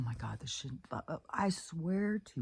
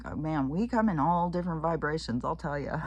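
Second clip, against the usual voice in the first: usual speaking voice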